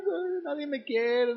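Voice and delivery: a pastor mimicking a self-pitying teenager, whining high; whiny teen. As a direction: ham up the sulky teen, stretched out. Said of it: "Acordémonos cuando éramos niños o cuando éramos adolescentes".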